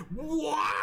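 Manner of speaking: high-pitched astonished "what"; a stunned crowd's high yelp; shocked outburst. Acted. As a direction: react in disbelief, voice shooting up high. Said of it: "they're all like".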